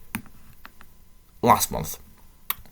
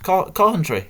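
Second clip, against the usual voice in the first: higher, amazed repeat of Coventry; surprised; 9 semitones higher, 3 dB louder than usual. Real, not acted. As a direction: disbelief on Coventry.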